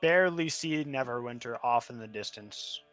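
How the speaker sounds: ordinary talk, nothing marked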